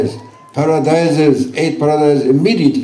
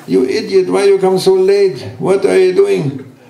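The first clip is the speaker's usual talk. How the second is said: higher-pitched scolding ego, mildly hammed up; nagging ego voice; 6 semitones higher than usual. The ego nags a tired husband, mock scolding voice, higher. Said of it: "Your ego is saying".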